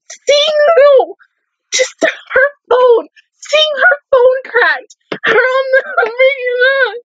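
high, slow crying shout; sobbing. Real, not acted. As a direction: wail it out through real tears. Breaking down recalling the scene.

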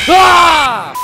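sudden high scream at a jump scare; startled scream. Real, not acted. Gamer jolted by jump scare, screaming. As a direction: shriek as the animatronic lunges.

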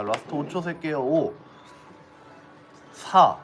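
slow final countdown with pauses; auctioneer. Acted. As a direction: count down to the gavel.

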